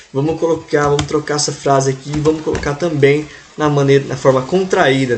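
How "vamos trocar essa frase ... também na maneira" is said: ordinary delivery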